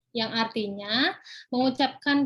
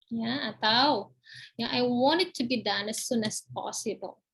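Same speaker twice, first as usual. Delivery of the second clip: ordinary talk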